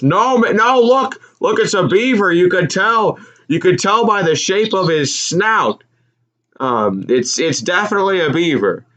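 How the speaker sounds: high, emphatic mock insistence; insistent Walton. Walton arguing with his partner, high insistent mock voice. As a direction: insist it's obviously a beaver.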